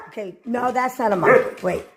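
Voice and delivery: ordinary talk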